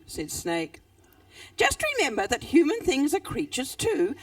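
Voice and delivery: put-on lively read-aloud voice for children; animated storytelling with character voices; story reading. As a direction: tell it like a bedtime story. Author reads her story aloud.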